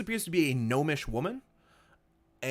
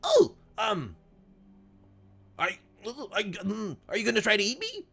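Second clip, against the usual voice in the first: put-on higher, hesitant old gnome voice; nervous old gnome; 7 semitones higher than usual. Old gnome asking the swimmer if it will eat him, nervous stammer. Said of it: "And he's like".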